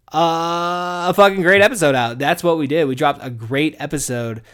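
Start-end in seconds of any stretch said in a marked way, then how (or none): none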